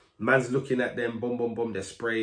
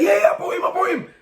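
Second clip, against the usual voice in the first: boastful shout; 9 dB louder, faster than usual. Retold or imitated. Brother boasting loudly at show.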